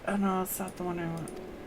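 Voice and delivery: ordinary talk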